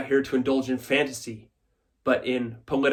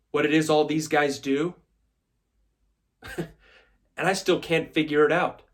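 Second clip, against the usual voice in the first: normal talking voice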